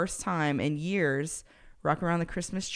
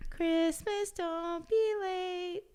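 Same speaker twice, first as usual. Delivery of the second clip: high squeaky chipmunk, much higher, slow; 12 semitones higher, 5 dB quieter, much slower than usual. Shown as a demonstration. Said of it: "that was a good Chipmunk voice on my behalf".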